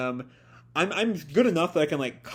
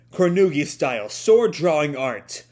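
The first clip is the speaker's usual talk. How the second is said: attack call; 8 dB louder than usual. Acted. Reading attack name aloud.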